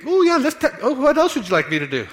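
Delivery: mock a sweetly biting wife; sarcastic wife. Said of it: "do you think she's gonna be".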